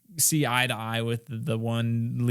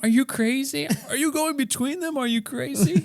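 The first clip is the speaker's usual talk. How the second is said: much higher, incredulous put-on voice; mock alarmed bystander; 14 semitones higher than usual. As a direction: squeal it like a horrified onlooker. Mocking the risky boat stunt.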